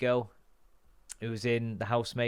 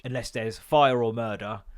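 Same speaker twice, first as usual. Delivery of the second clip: ordinary talk, nothing marked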